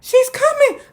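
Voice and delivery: high, slow, urgent warning; mock alarmed announcing. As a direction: announce it like the danger is here. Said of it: "Grandma got up and said".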